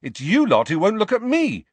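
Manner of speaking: high, loud, angry comeback. A narrator's reading. Harry blaming his friends, angrily. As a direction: fire the blame back angrily. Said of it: "said Harry angrily".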